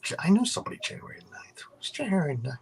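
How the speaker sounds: hushed aside under the breath; whisper. Real, not acted. Host whispering an aside to a guest.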